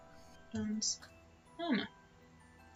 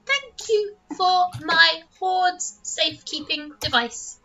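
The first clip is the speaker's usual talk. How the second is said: little dragon voice; 9 semitones higher, slower than usual. Acted.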